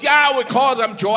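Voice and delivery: breezy Irish certainty; Irish comic. Retold or imitated. Irish comic explaining names, mild Irish. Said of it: "And he goes".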